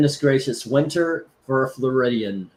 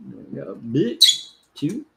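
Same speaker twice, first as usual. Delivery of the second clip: usual speaking voice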